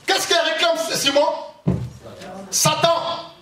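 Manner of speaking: ordinary talk, nothing marked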